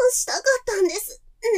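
high, fast, panting; breathless moaning. Acted. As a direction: gasp it out in pleasure. Gasping during sex. (高い声で喘ぎながら早口で)

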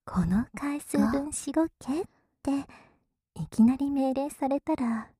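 whispering. Acted. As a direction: tease in a hushed whisper. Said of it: "ささやいてる反対のお耳から".

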